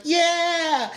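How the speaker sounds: very high mock cheer; stoked. Imitating girl in ad stoked about her score, mock-excited squeal. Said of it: "stoked about it".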